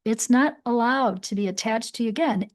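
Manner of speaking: normal talking voice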